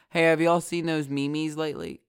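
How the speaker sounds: normal talking voice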